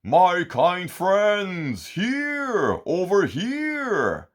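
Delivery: high, slow call across the waves. A narrator's reading. Sea giant calling to friends over the waves, loud and high. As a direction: call them over from far away. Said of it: "A voice calls out".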